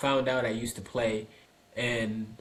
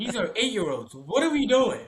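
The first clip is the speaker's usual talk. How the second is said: higher, incredulous retold protest; exasperated disbelief; 8 semitones higher, 3 dB louder than usual. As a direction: protest in baffled exasperation, rising in pitch. Retold exasperation at kids' practice.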